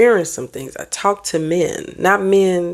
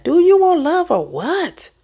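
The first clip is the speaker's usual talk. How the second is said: exasperated challenge; 12 semitones higher, 3 dB louder than usual. Real, not acted. Challenging commenters, high pitched.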